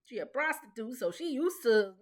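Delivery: ordinary talk, nothing marked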